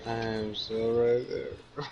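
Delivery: slow, drowsy tipsy mumble. Real, not acted. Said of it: "Oh god, I'm right there".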